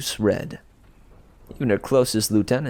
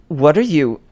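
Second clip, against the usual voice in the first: sharp, higher and louder; startled demand; 6 semitones higher, 7 dB louder than usual. Acted. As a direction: snap the question out in alarm. Drew confronts Piper.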